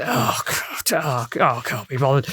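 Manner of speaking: weary indifference. Retold or imitated. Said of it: "typical of a Norfolk attitude".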